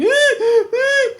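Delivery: very high, loud wails; imitated labour cries. Girlfriend leaning on the bed, high pained wails. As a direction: wail high like someone in labour.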